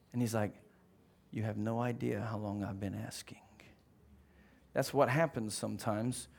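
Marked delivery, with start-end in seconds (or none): 1.3-3.4 low near-whisper, weary of asking; quiet, restrained whispered rebuke; hushed reply. Acted. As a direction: answer very softly after the outburst. God answers quietly after the yell.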